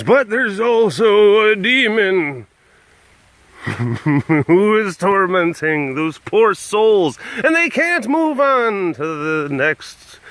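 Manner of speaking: high, loud, drawn-out mock horror pitch; slow, overblown movie melodrama; melodramatic. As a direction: wail the plot like a hammed-up ghost story. Hammed-up ghost movie synopsis.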